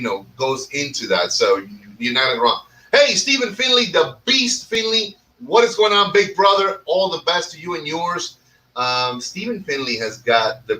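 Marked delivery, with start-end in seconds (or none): 2.9-8.4 high, bright call-out greeting to a friend; excited shout-out. Real, not acted. Host greeting viewer Stephen on the live stream, excited call-out.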